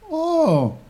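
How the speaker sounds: very high, loud oh; high childlike reaction; boy Peter's oh. A narrator's reading. Peter takes in his uncle's explanation, high oh.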